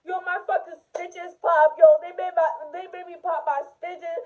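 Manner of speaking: loud, high, upset outburst retold; crying but yelling. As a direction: act out someone yelling through tears. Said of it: "crying but yelling".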